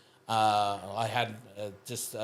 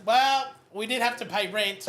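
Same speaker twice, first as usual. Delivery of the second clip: high, louder mock wife voice; wife explaining; 10 semitones higher, 4 dB louder than usual. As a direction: gently break the news. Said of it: "she goes".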